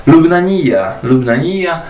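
lubnanija ending in ija; Arabic pronunciation. Shown as a demonstration. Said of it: "ta sylaba końcowa ija". (arabska wymowa lubnanija)

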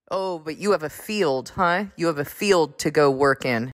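ordinary delivery

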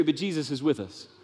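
ordinary talk

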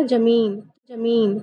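ज़मीन said "jameen" each time; wrong j sound. Shown as a demonstration. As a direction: start each ज़मीन on j, not z. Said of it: "इसका गलत उच्चारण होगा".

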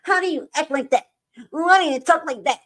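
high, mock-outraged imitation; high mock critics' voice; mocking critics. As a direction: mimic the judgmental critics. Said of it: "Talking about".

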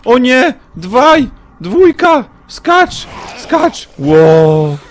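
very high, loud frantic yells; panicked shouting. Real, not acted. Streamer screaming at his character to jump during a chase, frantic and high. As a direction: scream urgent commands in a panic.

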